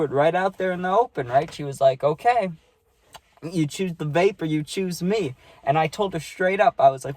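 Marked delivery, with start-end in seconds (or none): 2.0-5.3 slow, drawn-out ultimatum in a lightly voiced girl; slow put-on voice for the ex; girl's ultimatum. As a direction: lay down the choice slowly.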